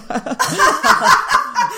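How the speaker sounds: high, loud burst of laughter. Real, not acted. Guest laughs at joke.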